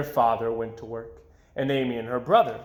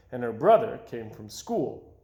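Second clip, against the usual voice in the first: ordinary delivery, nothing marked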